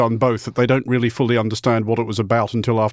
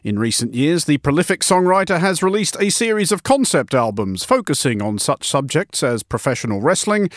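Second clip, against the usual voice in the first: radio intro read; 4 semitones higher than usual. Acted. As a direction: introduce the guest like a radio host. Host reads show introduction.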